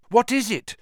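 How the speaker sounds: high, loud urgent question. A narrator's reading. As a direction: demand it urgently. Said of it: "said Harry urgently".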